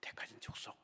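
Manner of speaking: soft breathy whisper. Real, not acted. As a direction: breathe the words. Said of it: "whispered recap".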